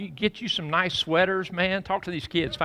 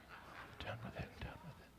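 whispered aside; 27 dB quieter than usual. Real, not acted. Preacher whispers to the congregation that he is done with it. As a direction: whisper it under the breath.

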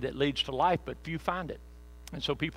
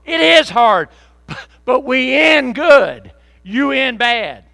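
loud, higher, slow call with a laugh; shouting back; 10 semitones higher, 16 dB louder, slower than usual. Pretend. Believer shouting back down to the friend, loud and laughing. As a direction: holler it across the valley.

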